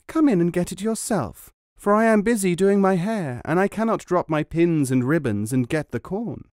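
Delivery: lightly voiced idle young wife busy with her hair. A narrator's reading. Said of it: "The woman replied".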